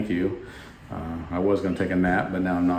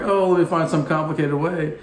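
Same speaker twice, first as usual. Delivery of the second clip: higher, louder mocking voice of the crowd; a lightly mocking higher everyone-else voice; mock fussy crowd; 8 semitones higher, 3 dB louder than usual. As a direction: mimic people overthinking it. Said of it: "Everybody's like".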